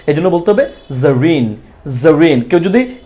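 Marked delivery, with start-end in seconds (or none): 1.0-2.4 "জরিন" as "Zareen", buzzing z; z sound. Shown as a demonstration. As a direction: model "জরিন" with an initial z, falling at the end. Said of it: "জয়ের মতো করে উচ্চারণ হবে".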